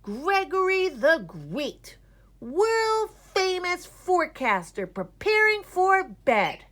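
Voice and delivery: high, loud, slow pompous announcement; boy grandly admiring himself; grand self-title. A narrator's reading. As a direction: proclaim yourself to the mirror.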